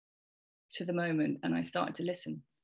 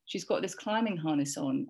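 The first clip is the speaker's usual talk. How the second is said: ordinary delivery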